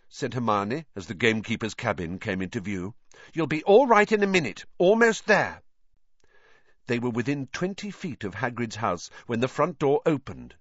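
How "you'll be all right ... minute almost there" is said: high, louder anxious encouragement; high-pitched urgent reassurance; encouraging girl. A narrator's reading. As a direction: urge him on in a raised girl's voice. Hermione encouraging sick Ron.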